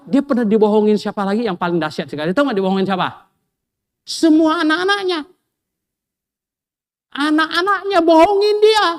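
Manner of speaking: high, loud, driving sermon voice; preaching at full force. Acted. Preacher to congregation, rising to a loud, high pitch.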